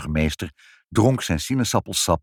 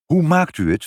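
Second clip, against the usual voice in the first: high, louder worried question; concerned; 11 semitones higher, 6 dB louder than usual. A narrator's reading. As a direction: ask with anxious concern. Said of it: "vroeg moeder bezorgd".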